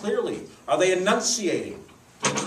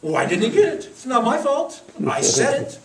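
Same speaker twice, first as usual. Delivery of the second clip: higher, louder frustrated protest; indignant inner voice; 9 semitones higher, 7 dB louder than usual. Retold or imitated. Speaker recalling a friend's critique, indignant. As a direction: defend yourself, baffled. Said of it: "I remember in the moment thinking".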